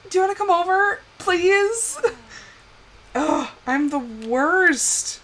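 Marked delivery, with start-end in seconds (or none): 0.1-2.0 high voice for a begging pet; pleading pet. Acted. Streamer voicing Gunther wanting attention, pleading. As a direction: imitate a hopeful little plea. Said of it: "he's like".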